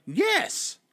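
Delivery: high, loud mock cheer of the viewers. Viewers' useless reply, mocked as a high, loud yelp. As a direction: squeal a dumb, eager yes in their voice. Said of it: "they just went".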